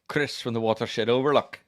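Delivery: loud put-on Scottish accent. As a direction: announce it in a hammy Scottish accent. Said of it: "did you miss my Scottish accent".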